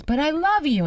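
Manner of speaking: high, hammed-up declaration; film lover's plea. Quoting the lover to Cher in Moonstruck, pleading. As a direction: plead it like a lovesick film hero.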